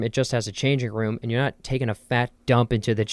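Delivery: normal talking voice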